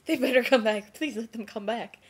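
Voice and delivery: high, loud start easing into a plea; urgent pleading. Retold or imitated. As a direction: imitate someone desperate for help. Helper watches car drive off.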